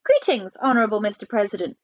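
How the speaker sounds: usual speaking voice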